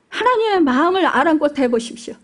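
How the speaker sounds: ordinary talk, nothing marked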